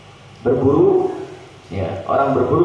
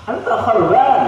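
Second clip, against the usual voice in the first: mock angry insult; 10 semitones higher than usual. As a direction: hurl the word harban in mock anger. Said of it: "marah sekali orang dikatakan".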